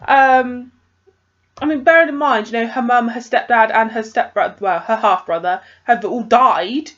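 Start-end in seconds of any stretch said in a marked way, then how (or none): none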